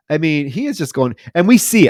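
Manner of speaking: ordinary talk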